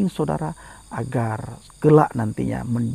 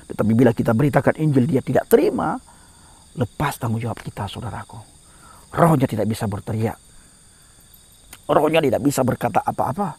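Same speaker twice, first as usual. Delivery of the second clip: ordinary talk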